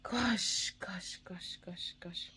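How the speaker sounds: hushed, near-whispered exasperation; stunned muttering to oneself; frustrated disbelief. Retold or imitated. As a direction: repeat it under the breath, dropping away after the first. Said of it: "I was so frustrated".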